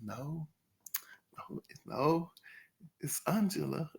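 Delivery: soft, firm "no, no, it's Angela"; quiet firm correction. Retold or imitated. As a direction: correct them quietly but firmly. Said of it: "Angela was like".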